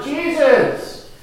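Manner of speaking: high, loud cry above the crowd; desperate shout for Jesus over a noisy crowd. Acted. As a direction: yell out to get his attention. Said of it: "I just yelled out louder".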